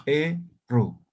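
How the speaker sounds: E-ru with an open e. Shown as a demonstration. Teacher repeating the right way, E-ru with e. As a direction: repeat E-ru, e not i. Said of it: "como si fuera vocal E".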